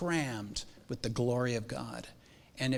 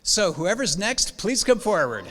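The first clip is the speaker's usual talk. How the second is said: calling out; 7 semitones higher, 8 dB louder than usual. Real, not acted. Speaker calling the next parent forward, loud and high. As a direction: summon the next speaker from the back of the hall.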